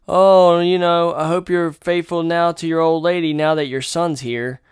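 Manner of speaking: mocking the critic who came at his throat; mock sanctimonious critic. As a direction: lecture him like a smug busybody.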